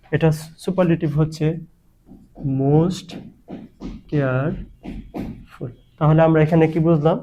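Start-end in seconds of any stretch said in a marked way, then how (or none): 2.6-5.7 most careful very slowly, falling softer on careful; syllable by syllable. Shown as a demonstration. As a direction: sound out most, care, ful with long gaps. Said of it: "এটা কিন্তু দুই syllable বিশিষ্ট". (most careful ধীরে থেমে থেমে ভেঙে বলা)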